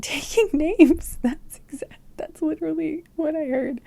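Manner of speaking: high, giggly delivery; amused laughing. Real, not acted. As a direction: laugh through the words in delight. Amused by the phrase received.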